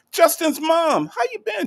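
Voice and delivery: very high, bright welcome call; joyful greeting. Real, not acted. Greeting a regular viewer in a very high, joyful call. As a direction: sing out the welcome.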